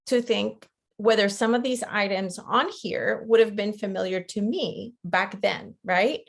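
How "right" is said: ordinary delivery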